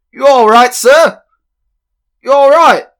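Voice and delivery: high, loud, urgent calling; alarmed attendant. Acted. Somebody shaking the fainted man, alarmed. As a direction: call out to rouse someone who fainted. Someone shaking him awake.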